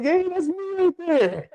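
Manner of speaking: very high, drawn-out mock squeal; excited squeal. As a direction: squeal at spotting yourself on screen. Excited self-recognition, retold squeal.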